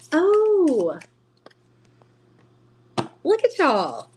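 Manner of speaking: fond excitement at the cards; delighted. Real, not acted.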